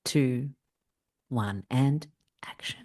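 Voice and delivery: slow whispered countdown; whispering. Acted. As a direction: whisper the cue, slowly. Counting in the performance.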